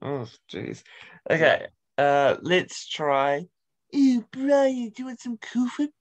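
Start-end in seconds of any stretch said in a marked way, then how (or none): 3.9-5.9 high cartoon baby-villain impression; put-on high character voice; Stewie. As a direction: pitch it up like a precocious cartoon baby. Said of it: "that's Stewie".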